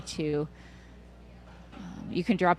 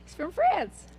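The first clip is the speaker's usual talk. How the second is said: high mock voice; 12 semitones higher, 5 dB louder than usual. Mock reply.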